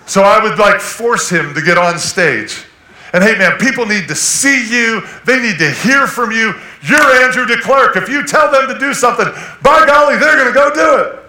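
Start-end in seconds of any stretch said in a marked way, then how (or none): 3.1-11.2 loud, high, hammed-up urging; loud pushy pep talk. Pastor badgering his shy friend, loud and pushy. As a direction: push him hard to get on stage.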